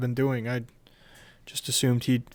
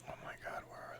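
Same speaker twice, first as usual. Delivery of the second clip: whisper; 27 dB quieter, faster than usual. Real, not acted. Quiet panic mid-session.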